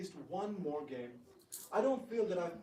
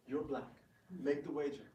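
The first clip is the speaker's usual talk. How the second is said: usual speaking voice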